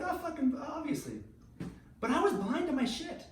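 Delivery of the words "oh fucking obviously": mock obvious. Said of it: "you'd be like".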